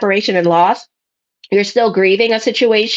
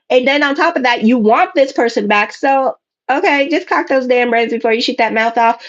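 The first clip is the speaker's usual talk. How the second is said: ordinary delivery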